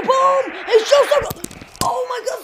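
loud, high burst of goal excitement; excited shout. Real, not acted. Gamer commentating his own shot on goal, excited shout.

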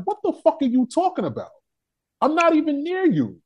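high-pitched indignant comeback; retold angry retort. Arguing back with the woman.

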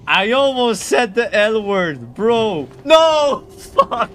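loud cry of dismay, climbing very high; dismayed outburst. Real, not acted. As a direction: yell it as if you just lost a bet. Slips on self-imposed word ban.

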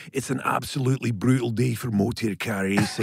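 put-on Scottish accent; Scottish racing driver's commentary; Jackie Stewart. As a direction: roll into a broad Scottish accent. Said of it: "Jackie Stewart".

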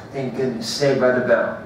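plain reading voice

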